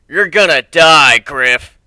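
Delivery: loud, slow threat; murderous threat. Acted. Carl pulling a knife on Griff, loud slow threat. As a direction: snarl it out as you pull the knife.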